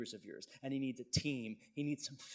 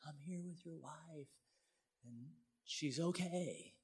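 higher, hushed and drawn-out tearful imitation; imitated hysteria; 5 semitones higher, 4 dB quieter, slower than usual. Preacher retells the stranger's call about his wife, imitated hysteria. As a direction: act an overwrought caller, breathy whisper, pitched up. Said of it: "she's hysterical".

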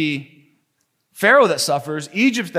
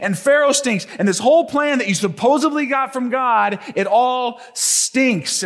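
higher, louder whiny accusation; complaining people; 7 semitones higher, 3 dB louder than usual. Acted. Hebrews blaming Moses for the harder work, whiny and raised. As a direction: gripe at Moses, pitched up and loud. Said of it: "The people say".

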